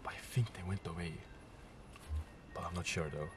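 hushed, uneasy half-whisper; nervous night vlog whisper. Real, not acted. Retelling scratching noises, hushed at night.

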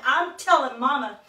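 put-on a stomping child threatening to tell; bossy little princess. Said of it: "stomped her foot and crossed her little arms".

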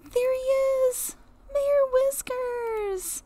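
high, slow, delighted call; delighted finding. Real, not acted. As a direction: stretch the name out in joy. Finds the cat.

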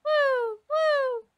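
high, drawn-out "woo"; bird cry. Pretend. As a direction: imitate a bird hooting. Imitating the bird's cries.

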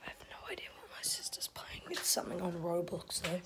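hushed whisper so mum can sleep. Real, not acted. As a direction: whisper it, unvoiced. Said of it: "I really wanna be quiet".

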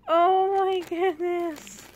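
slow, loud, high delighted exclaim; excited. Real, not acted. Vlogger thrilled by Ashley's gift, loud excited gush. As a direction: gush over the surprise.

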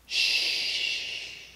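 a long, soft unvoiced shush, like soothing a baby. Shown as a demonstration. Jesus hushing the storm like a mother with a baby, soft shush. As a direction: hush it the way you settle a child to sleep. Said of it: "to get them to go to sleep".

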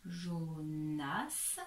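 "Jonas" in two slow syllables, s audible; sounded final S. Shown as a demonstration. Teacher shows the S is not silent, slow. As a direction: finish "Jonas" on a clear s. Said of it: "on prononce bien le S".

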